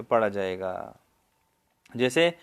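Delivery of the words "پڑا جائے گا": ordinary delivery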